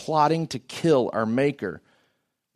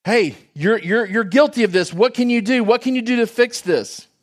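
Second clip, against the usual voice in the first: high, loud, urgent challenge; insistent preacher acting out an accusation; Peter confronting crowd; 7 semitones higher, 5 dB louder than usual. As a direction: confront the guilty crowd, voice raised. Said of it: "What's Peter say?".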